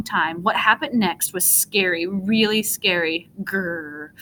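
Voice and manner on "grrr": soft, low snow monster growl. A narrator's reading. Monster hiding behind tree, low quiet growl. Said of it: "A low growling came from behind the tree".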